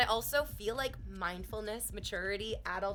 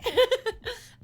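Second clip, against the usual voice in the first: high, loud laugh; high burst of delighted laughter; 13 semitones higher, 11 dB louder than usual. Real, not acted. Laughing at the compliment.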